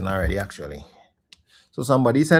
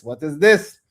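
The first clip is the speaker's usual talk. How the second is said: louder, rising disbelief; what is this, louder with a rising end; incredulous; 4 semitones higher, 6 dB louder, slower than usual. Real, not acted. Repeated outrage, louder.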